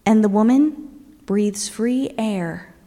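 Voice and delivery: ordinary talk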